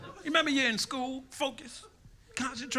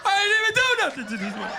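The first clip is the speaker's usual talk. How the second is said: put-on high, loud, fast pleading kid; whining kid; 11 semitones higher, 6 dB louder, faster than usual. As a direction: protest fast like a panicked boy.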